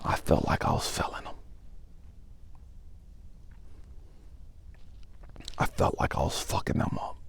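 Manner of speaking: barely able to get it out; hushed, defeated whisper; broken whisper. Real, not acted. Quiet after breaking down.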